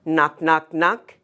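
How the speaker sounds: plain reading voice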